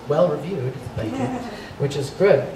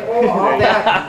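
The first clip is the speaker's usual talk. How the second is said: put-on Minnesota accent; 3 semitones higher, 4 dB louder, slower than usual. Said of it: "she's like".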